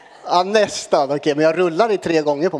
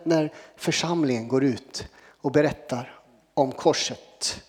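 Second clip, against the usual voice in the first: normal talking voice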